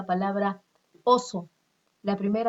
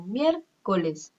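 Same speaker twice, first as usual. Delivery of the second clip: miércoles with MIÉR raised and punched; stress on MIÉR; much slower than usual. Shown as a demonstration. Teacher showing the tonic syllable, stress on MIÉR in miércoles. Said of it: "Mier va a ser tónica".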